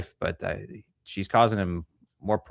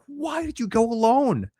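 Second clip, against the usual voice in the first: much higher, slow put-on voice; mocking incredulous voice; 13 semitones higher, slower than usual. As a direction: drag it out, baffled and mocking. Mocking reply to the wife.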